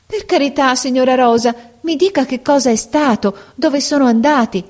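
high, begging entreaty; pleading. A narrator's reading. The girl implores the portress, high and pleading. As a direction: beg the portress for any news. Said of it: "con tono supplichevole".

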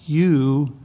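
"you" pushed, set apart with a pause; emphatic you. Shown as a demonstration. Preacher to congregation, stressing "you" on its own. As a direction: land on "you".

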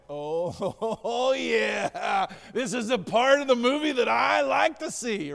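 loud laughing glee; laughing delight. Pretend. Believers watching God's arrival, laughing with delight. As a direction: laugh through it, thrilled.

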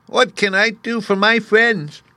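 very high, slow mouse queen; squeaky little voice. A narrator's reading. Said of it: "in her squeaky little voice".

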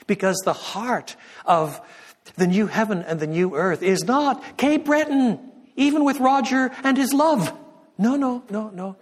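high, loud, building preacher's cry; full-force preaching climax. Real, not acted. Preacher telling congregation what heaven is not, crying out. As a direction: cry it out to the back of the church.

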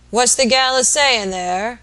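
slow, high, loud invalid's roar; subdued roar. A narrator's reading. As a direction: roar it out from the sickbed, drawn out. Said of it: "asked the invalid in a subdued roar".

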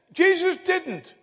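put-on high, drawn-out childish protest; little boy. Little boy correcting the preacher, high child's voice. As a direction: insist like an indignant child. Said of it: "one little boy".

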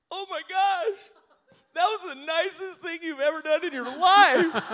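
very high, loud weepy falsetto; exaggerated wife imitation. Husband mimicking his wife's praise, exaggerated tearful squeal. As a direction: wail it in a hammed-up squeal, overcome. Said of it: "I love imitating my wife at this point. This is not how she sounds".

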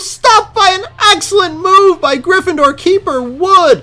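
fake sports announcer. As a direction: call every play like the game hangs on it. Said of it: "use my fake announcer voice".

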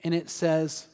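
normal talking voice

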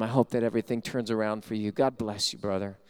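soft, low blessing; gentle blessing. Retold or imitated. Pastor blessing the beggar, soft and low. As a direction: quietly bless the man. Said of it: "Tells him".

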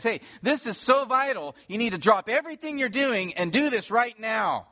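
like waking someone to drop everything; urgent call. Acted. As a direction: voice up high, pressing every word. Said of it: "It says".

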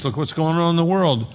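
ordinary delivery

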